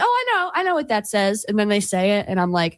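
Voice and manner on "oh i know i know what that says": high-pitched eager "I know it!" outburst; eager guesser. Retold or imitated. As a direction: act out jumping in with the answer. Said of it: "I'll be like".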